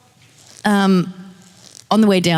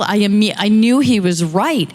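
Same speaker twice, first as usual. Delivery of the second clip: ordinary delivery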